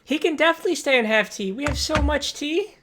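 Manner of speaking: put-on high, fast, loud little girl voice; little girl shouting back. GM voicing the little girl answering from the other room, high and loud. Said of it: "the little girl shouts back".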